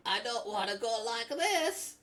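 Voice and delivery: high, loud, slow Scottish put-on; Scottish accent. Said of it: "with the Scottish accent".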